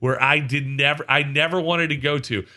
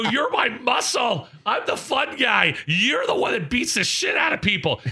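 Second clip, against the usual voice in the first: retold outrage; 9 semitones higher than usual. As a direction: hammer out the complaint indignantly. Scolding friend for missing fight.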